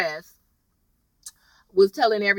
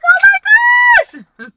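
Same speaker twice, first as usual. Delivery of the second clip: high, loud "oh my gosh"; shocked gasp; 8 dB louder, much faster than usual. Retold or imitated. Reacting to the flashback of Wes with a knife, shocked. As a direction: squeal it in shock. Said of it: "I was like".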